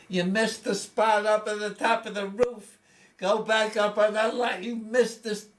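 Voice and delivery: high, loud imitated nagging; nagging mother. Mother scolding son painting the house, loud high nag. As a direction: berate him for sloppy work, loud and high.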